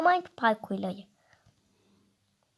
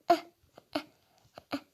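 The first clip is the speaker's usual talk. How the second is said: usual speaking voice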